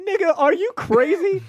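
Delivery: high, incredulous outburst; outraged phone call. Retold or imitated. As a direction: demand it like they've lost their minds. Motown calling station, outraged.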